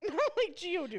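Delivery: high, loud, laughing mimicry; laughing echo. Mocking co-host's defense of Geodude, laughing. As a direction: echo it back through laughter.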